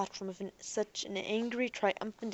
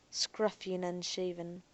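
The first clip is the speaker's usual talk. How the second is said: ordinary delivery, nothing marked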